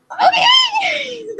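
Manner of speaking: very high laughing squeal of excitement; starstruck squeal. Retold or imitated. Starstruck reply to photo offer.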